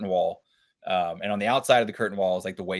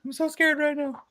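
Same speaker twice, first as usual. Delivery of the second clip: high, breathless fake fright; mock-scared horror victim. Joking along about a Blair Witch movie, mock-scared. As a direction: play at being terrified in found footage. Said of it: "a Blair Witch, like, movie".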